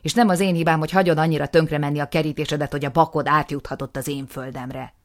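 loud, scornful retort; heated argument. Acted. Aftab to his brother, loud and scornful. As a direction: blame him right back.

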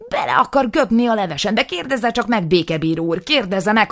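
loud, high, escalating tirade; furious rant. Acted. Nisim raging at his brother, loud and high. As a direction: build to a furious yell.

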